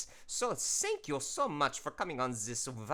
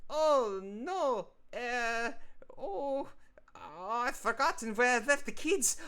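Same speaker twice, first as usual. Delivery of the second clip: high, loud, frantic cartoon voice in a hammed-up bad accent; panicky photo frame; 9 semitones higher, 4 dB louder than usual. Artist voicing Franz the picture frame, panicked and high. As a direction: wail it like a frame that lost its kids. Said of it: "my bad accent aside".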